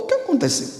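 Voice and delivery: puzzled. Retold or imitated. Friend left puzzled.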